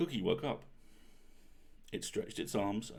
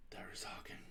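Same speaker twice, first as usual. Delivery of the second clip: whispered aside; 13 dB quieter than usual. A narrator's reading. Wry aside after rum cake.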